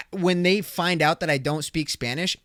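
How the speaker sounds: ordinary talk, nothing marked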